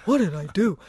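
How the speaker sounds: lightly voiced puzzled dog; innocent dog voice. Scolded border collie to owner, lightly acted innocence. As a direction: play a mildly wronged dog protesting innocence. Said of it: "And he was like".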